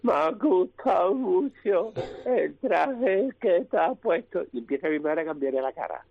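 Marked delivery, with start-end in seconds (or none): 0.0-4.4 slow, high, put-on old aristocrat voice; slow mock-posh duchess impression; Duquesa de Alba. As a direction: drag it out like a grand old lady, much higher. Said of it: "Y empieza la duquesa de Alba".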